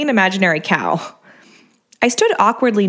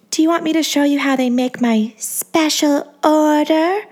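high breathy voice; 7 semitones higher than usual. A narrator's reading. Angeline's voice again.

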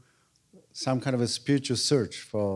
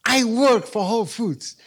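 put-on young woman; 8 semitones higher, 4 dB louder than usual. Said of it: "and she say".

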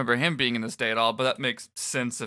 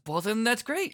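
Fret voice; 11 semitones higher than usual. Acted. Fret's line.

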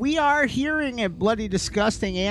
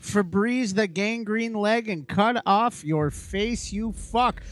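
show announcer; 5 semitones higher than usual. Acted. Host's wacky show opener.

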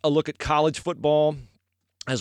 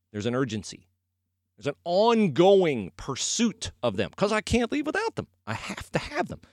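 usual speaking voice

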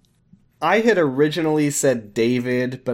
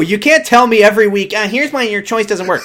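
loud, high-pitched protest; raised-voice comic indignation; indignant outburst; 9 semitones higher, 7 dB louder than usual. Real, not acted. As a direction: argue back loudly in exasperation. Mock outrage at co-host's objection.

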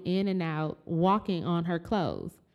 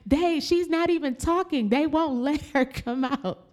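high insistent they're lying; high mock outrage; 8 semitones higher, 3 dB louder than usual. Daughter telling sister the staff are lying, high insistent outrage. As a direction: insist hard, half laughing. Retold outburst, laughing.

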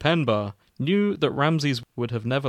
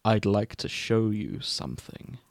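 low, soft hiss; 3 semitones lower, 5 dB quieter than usual. Acted. Hannibal luring Ramsay to the kitchen, low hiss. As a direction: hiss it like a snake, quietly. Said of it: "he hissed".

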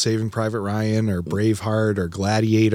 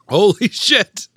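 loud, high startled outburst; shocked exclamation; 10 semitones higher, 5 dB louder, slower than usual. Real, not acted. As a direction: blurt it out, caught off guard. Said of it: "that is the tartest thing".

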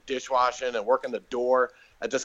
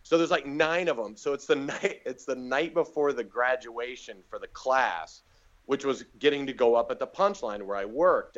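ordinary talk, nothing marked

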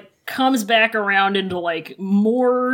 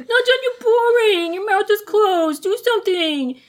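higher, needling complaint; whiny nagging; 9 semitones higher than usual. Acted. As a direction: poke at him to say something. Mock nagging a silent partner.

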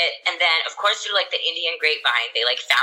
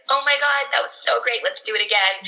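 high, thrilled rush of words; buzzing after a win, pitch way up; excited; 8 semitones higher than usual. Retold or imitated. After her first TV segment, gushing excitedly.

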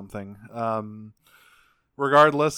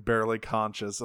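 ordinary talk

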